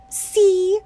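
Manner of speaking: put-on high, loud girlish complaint; a fed-up little girl; young girl Winnie. Winnie complaining to the toad, high girlish voice.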